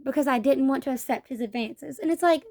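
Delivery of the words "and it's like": ordinary delivery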